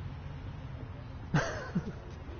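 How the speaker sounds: high airy giggle; giggling. Real, not acted. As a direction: giggle to yourself. Laughs at his own choice.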